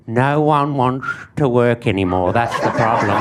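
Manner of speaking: low, grumbling boomer voice; mock a cranky old-timer; grumpy old man. Comedian mocking old people complaining about youth, low grumbling.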